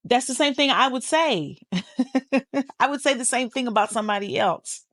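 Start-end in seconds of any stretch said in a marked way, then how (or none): 1.6-2.7 short chuckling burst; a quick run of real chuckles; laugh. Real, not acted. Laughing at what listeners would say, amused.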